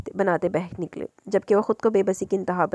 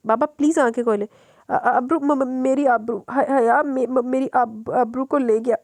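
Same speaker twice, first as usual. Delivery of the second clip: slow, stammering, higher delirious cry; distressed stammering; 5 semitones higher, 4 dB louder than usual. Acted. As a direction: stumble over the words in despair. (हकलाती, बेचैन बड़बड़ाहट)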